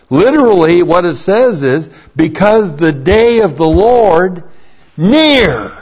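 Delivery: preacher's loud emphatic reading; emphatic quote. Shown as a demonstration. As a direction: hammer each word of the verse home. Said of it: "very emphatic".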